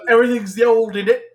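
high, loud mock London voice; bad London accent. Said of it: "intentionally bad London accent".

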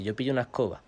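ordinary talk, nothing marked

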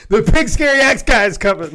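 high, loud warning through laughter; giggly hammed-up alarm; laughing mock alarm. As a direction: pretend panic while cracking up. Joins the joke panic, laughing.

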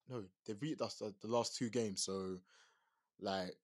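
normal talking voice

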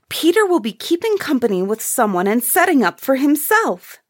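high, lively voice; girl's teasing cry. A narrator's reading. One of the girls teasing brother Peter, excitedly. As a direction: chime in high and eager. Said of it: "cried one of the girls".

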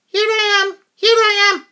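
put-on very high, squeaky child voice; tiny little girl. Tiny daughter answering her mother down the aisle, squeaky little-girl voice. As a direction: pipe up like a tiny lost daughter. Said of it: "she's tiny".

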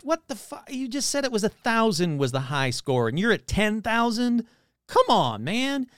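high, disbelieving cry of protest; catching the salesman in a lie, high indignant disbelief; incredulous outrage. Retold or imitated. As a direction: squawk it like you've been had. Outraged disbelief at ten thousand.

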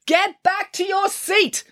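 high, drawn-out bellow; slow, strained high-pitched order. A narrator's reading. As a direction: bellow it down the bus. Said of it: "bellowed Mister Lang".

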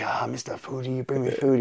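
high, whispery imitation of a soldier; hushed delight. Teller as Shabani offered stolen food, hushed delight. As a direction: drop to an excited, high hush.

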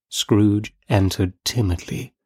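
plain reading voice

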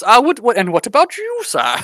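hammed-up mystic turning to Aloysius; mock Eastern European accent. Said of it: "he looks at Aloysius".

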